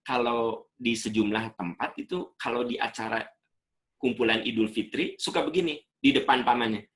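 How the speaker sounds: ordinary talk, nothing marked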